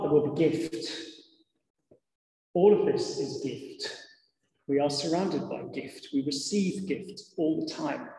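ordinary talk

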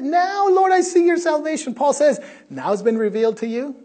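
very loud, high, ringing quotation; full-force preaching. Acted. Preacher loudly quoting Simeon and Paul to the congregation. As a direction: cry it out to the rafters.

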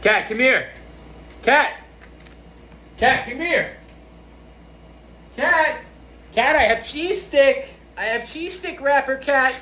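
sing-song the cat's name up high to lure her over; high pet-calling voice. Real, not acted. Owner calling the cat to come for the wrapper, high coaxing call.

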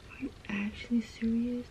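unvoiced exasperated whisper. Real, not acted. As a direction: whisper it in frustration. Whispering in frustration.

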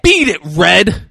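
slow, loud, high dismissive holler; heckler's yell. Retold or imitated. Cigar-smoking man to fish girl mascot, heckler's yell. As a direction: stretch it out and shout them away.

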